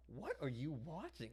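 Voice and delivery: imitating baffled brothers; mock brothers. Brothers asking what he's watching, mock puzzled. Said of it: "my brothers were like".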